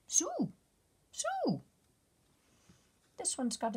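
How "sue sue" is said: very high squeaky call; little bug squeaking a call; tiny bug calling. Acted. Bugs calling out.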